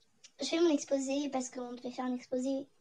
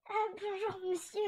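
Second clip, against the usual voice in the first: awkward greeting; 7 semitones higher, 5 dB quieter than usual. Retold or imitated. Caught, greeting teacher awkwardly.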